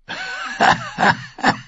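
high villain's cackle; laughing. A narrator's reading. Bone-armoured wildling laughs at the captive's promise, mocking cackle. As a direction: cackle in staccato bursts. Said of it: "als er lachte".

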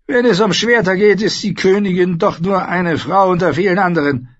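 high, loud, imperious queen voice; Cersei, haughty queen. Acted. Queen lecturing Sansa at the feast, haughty and loud. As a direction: play the proud queen lecturing the girl.

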